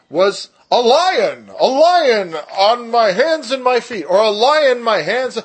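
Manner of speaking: slow, loud and high on lion; astonished emphasis. Real, not acted. Teacher recounting finding lion in his Bible, amazed. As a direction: lean into lion with wonder. Stunned Bible study discovery.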